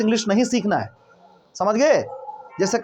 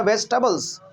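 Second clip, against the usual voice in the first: ordinary talk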